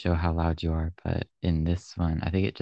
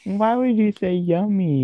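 much higher and louder, in disbelief; shocked disbelief; 13 semitones higher, 8 dB louder than usual. Real, not acted. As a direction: squeal out the question in shock. Friend shocked at the story.

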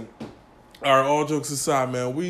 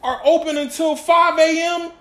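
much higher, louder disbelief; incredulous; 12 semitones higher, 8 dB louder than usual. Real, not acted. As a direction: sell the shocking hour. Incredulous about strip club hours.

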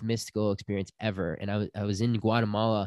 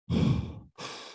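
big heavy exhale, imitated; huge breath. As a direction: blow out a huge breath, acting it out. Like, taking these huge breaths.

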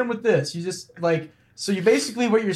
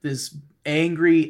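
ordinary talk

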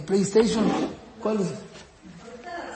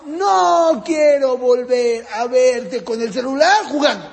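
slow, loud scolding shout; mother shouting at her son over the phone; 3 semitones higher, 4 dB louder, slower than usual. Retold or imitated. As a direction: lay down the law, angry. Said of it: "qué le dice la mamá".

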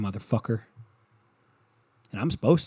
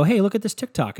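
high, louder chirpy partner voice; sing-song it like an excited partner; bright high mock voice showing off a video; 9 semitones higher, 5 dB louder than usual. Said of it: "they go".

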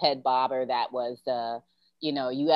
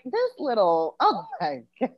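mock indignation; 6 semitones higher, 3 dB quieter than usual. Acted reaction to student.

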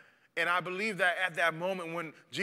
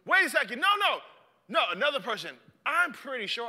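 nosy bystander; 6 semitones higher, faster than usual. Acted. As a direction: stop him like a suspicious stranger. Bystander voiced high.

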